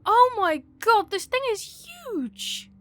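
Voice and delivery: high, loud startled outburst; shocked. Real, not acted. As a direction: blurt it out in alarm. Sees huge monster in game.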